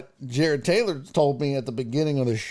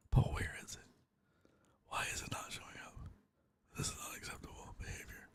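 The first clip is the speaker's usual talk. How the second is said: barely audible whisper to oneself; hushed, unvoiced grumbling; whispered muttering; 21 dB quieter, faster than usual. Real, not acted. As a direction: whisper under your breath while searching. Muttering to self while hunting post.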